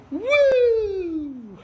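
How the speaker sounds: high loud whooping woo; mock whoop. Buddy whooping as he spins. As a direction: whoop like a guy spinning on a pole. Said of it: "He's like".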